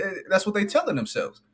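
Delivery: ordinary talk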